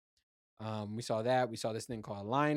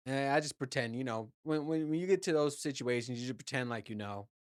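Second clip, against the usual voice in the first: ordinary talk, nothing marked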